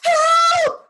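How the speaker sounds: very high, loud scream; roaring for help. A narrator's reading. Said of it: "roared Lion".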